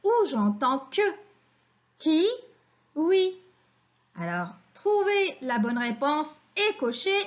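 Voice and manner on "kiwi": higher-pitched KIWI, cut into KI, pause, WI; split into syllables. Shown as a demonstration. Teacher helping children locate a sound, KIWI split slowly. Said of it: "où est la syllabe".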